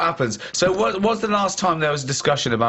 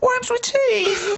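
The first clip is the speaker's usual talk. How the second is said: very high, drawn-out mock proclamation; hammed-up squeal, slow and high; big-guns announcement; much slower than usual. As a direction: stretch it out high as a ridiculous attention-grabber. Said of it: "gonna bring out the good, big guns now".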